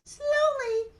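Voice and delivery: slow, high stretched words; drawn-out slowness. A narrator's reading. Storyteller describes the plodding cow, drawn out slowly. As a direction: stretch it out to match the walk.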